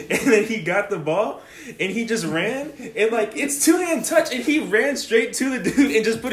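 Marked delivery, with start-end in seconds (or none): none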